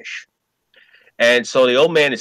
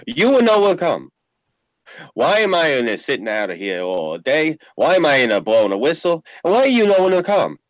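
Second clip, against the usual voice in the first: mock Italian immigrant accent, broken English; hammed-up Italian accent, grumbling; old immigrant father; 4 semitones higher than usual. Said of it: "He goes like this".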